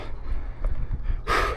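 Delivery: long breathy exhale after exertion; heavy sigh. Real, not acted. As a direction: let out a tired sigh. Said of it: "I'm a little out of breath".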